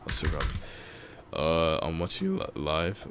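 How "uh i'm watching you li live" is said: voice cracking. Real, not acted. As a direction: voice cracks mid-word. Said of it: "why the hell is my voice cracking".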